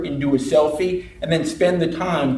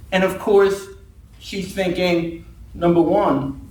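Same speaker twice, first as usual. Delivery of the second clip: usual speaking voice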